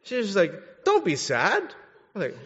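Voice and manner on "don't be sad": higher, louder mock mom brushing it off; a hint of a dismissive mother; mimicking mom's brush-off. Son retelling his mom's phone reply, mock dismissive. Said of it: "a terrible comforter".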